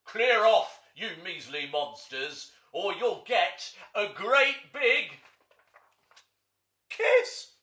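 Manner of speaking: loud, slow, booming threat rising to a high kiss; roaring monster. Acted. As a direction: bellow at the little monsters. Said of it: "roared a roar and hissed".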